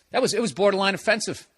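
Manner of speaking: usual speaking voice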